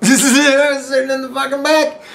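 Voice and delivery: high, amused laugh through the words; cracking up at the image of him in the cruiser. Real, not acted. Telling friends how the buddy sat in the cruiser, laughing.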